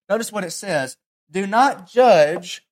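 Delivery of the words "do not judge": slow, loud, with not held long; solemn command. Acted. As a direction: stretch out do not judge firmly. Quoting Jesus' command.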